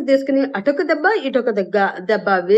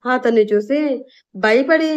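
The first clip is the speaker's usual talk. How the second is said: ordinary delivery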